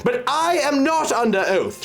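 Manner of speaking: savage cry. Acted. Sybil defying the oath before the doctor, savage cry. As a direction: throw the words at him almost savagely. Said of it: "she cried, almost savagely".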